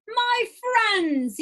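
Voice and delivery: a pitchman hailing the crowd; clear keen announcement. Acted. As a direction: announce it like a showman. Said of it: "he announced in a voice clear and keen".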